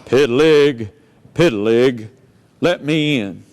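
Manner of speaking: wolf growling at the pig's door; big bad wolf. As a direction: drop way down like a menacing wolf. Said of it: "And he says".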